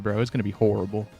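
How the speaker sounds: usual speaking voice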